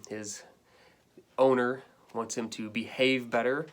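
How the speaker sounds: ordinary talk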